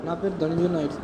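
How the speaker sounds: saying his name shyly under his breath; too quiet. Real, not acted. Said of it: "గట్టిగా, గట్టిగా మాట్లాడు". (చాలా మెల్లగా, సిగ్గుతో)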